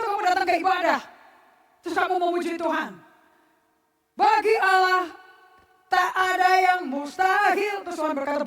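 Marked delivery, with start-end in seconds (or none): 1.9-7.8 mock praise. Mocking a worshipper's praise.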